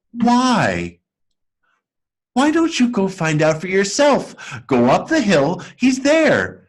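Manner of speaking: put-on a sweet girl's puppet voice pitched high and bright; Lucia's voice. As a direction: sing it out high as the cheerful girl.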